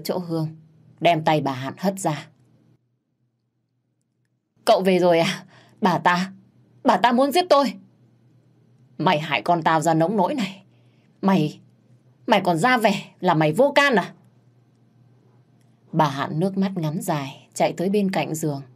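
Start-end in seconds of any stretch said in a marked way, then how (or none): 4.6-7.7 frightened plea. Acted. Maid pleads after being choked. 9.0-14.1 angry accusation. Acted. Mother accuses maid.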